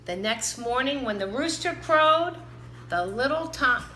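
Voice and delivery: ordinary delivery, nothing marked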